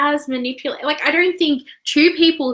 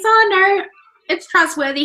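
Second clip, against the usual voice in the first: higher, louder imitated inner voice; naive mock thought; 8 semitones higher, 4 dB louder than usual. Co-host voicing Joe's thought about the door, mock naive. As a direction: play his misplaced trust, pitched up.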